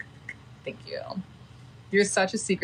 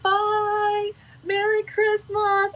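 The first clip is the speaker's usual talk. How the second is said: much higher, sing-song goodbye, slow on Merry Christmas; cheery farewell; 5 dB louder, much slower than usual. Retold or imitated. Passengers hanging over the rail to the departing ship, high sing-song farewell. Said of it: "they'll all hang over the side and be like".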